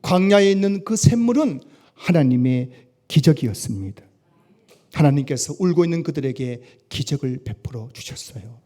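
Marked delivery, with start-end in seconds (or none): none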